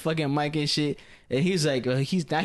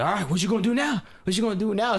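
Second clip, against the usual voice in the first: mock teammate yell; 7 semitones higher than usual. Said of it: "yelling every time he's dead".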